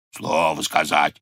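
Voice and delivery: deep bear growl; low-pitched bear echo; roaring bear. A narrator's reading. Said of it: "ревнул медведь".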